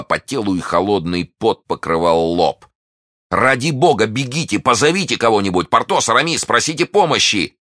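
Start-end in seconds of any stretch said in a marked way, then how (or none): 3.3-7.6 high, urgent shouting; raised, panicked calling; frantic plea. Acted. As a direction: beg everyone to run for help. Young man begs for help.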